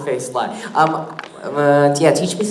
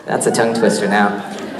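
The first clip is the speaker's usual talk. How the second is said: normal talking voice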